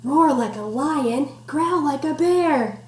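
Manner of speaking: slow rhythmic rhyme reading; sing-song read-aloud. Acted. Teacher reading rhyme to class, slow sing-song. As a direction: bounce the rhyme for kids. Reading picture book to children.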